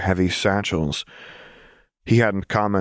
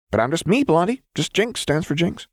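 high, perky protest; protesting Jinx; 10 semitones higher than usual. A narrator's reading. Jinx protesting to Lux, high and insistent. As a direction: insist brightly in a high character voice.

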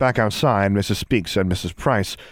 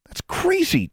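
much higher put-on boy voice; astonished boy; slower than usual. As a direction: react amazed as David.

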